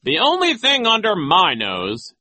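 high, loud, slow retort; pompous put-down, drawn out; Nero. A narrator's reading. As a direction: lord it over the children. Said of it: "Nero said".

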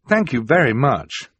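dutifully grateful; politely feigned delight. A narrator's reading. Violet thanks them for an unwanted present, feigned delight. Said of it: "say that you are delighted".

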